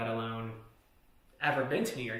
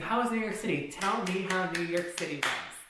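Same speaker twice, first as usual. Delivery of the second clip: high, sing-out retold taunt; pitched-up sarcastic interrogation; mocking question; 6 semitones higher, 4 dB louder than usual. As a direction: press a caught liar with mock interest. Acted taunt, he was embarrassed.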